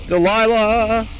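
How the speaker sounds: drawn-out excited call; excited shout. Real, not acted. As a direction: stretch the name out loud and high. Caster calls out a hero dying.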